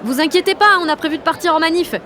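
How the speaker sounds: high, loud call-back; breezy bluff. Acted. Jeanne answers the supervisor through the gate, breezy bluff. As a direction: call it out with cheeky assurance.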